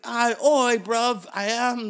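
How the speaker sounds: high, loud, drawn-out fake British; bad British accent. Prince confirming he is royalty, hammed-up British accent. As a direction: answer in an over-the-top British voice. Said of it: "And that's my British".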